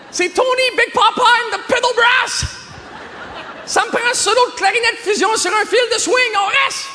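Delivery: very high, over-the-top shout; ecstatic fan shouting. Acted. Fan refusing to leave the jazz show, hammed-up ecstatic shout. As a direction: shriek it like a crazed jazz fan. Festival fan screaming about the band.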